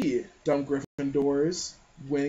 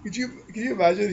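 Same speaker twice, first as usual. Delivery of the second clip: ordinary talk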